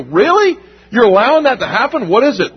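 high, disbelieving questions; mock the outsiders' shock; incredulous pagans. Pagans gasping at the church, incredulous.

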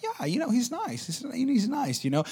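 put-on high, soft, evasive girl voice; imitated noncommittal girl. She's asked what she thinks of Aaron, noncommittal. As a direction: dodge politely in a small high voice. Voicing the girl's lukewarm, evasive answer.

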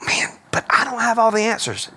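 lightly voiced hesitant objector; a hint of a worried churchgoer; imitating a doubter. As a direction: play the unsure listener. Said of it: "You go".

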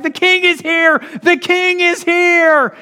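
high, loud cry down the street; acted town-crier shout, stretched out; excited yelling. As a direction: yell the news as you run. Man runs yelling down street.

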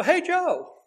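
put-on high, bright hello to an old pal; cheery casual hail. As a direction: call out "Hey, Joe" like to a buddy. Casual greeting to president.

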